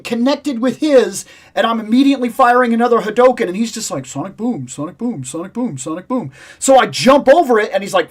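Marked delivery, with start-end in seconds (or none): 4.0-6.3 low, soft, monotonous rapid repeats; imitating a spammed attack. Gamer imitating Guile firing nonstop, monotonous mimicry. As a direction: mimic a game character mechanically repeating his move.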